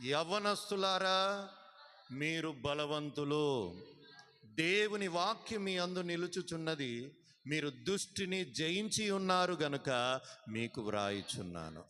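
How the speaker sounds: loud, slow, stretched proclamation; preaching scripture reading. Acted. Pastor proclaiming the verse to the youth, loud and slow. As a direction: declare it over the young people.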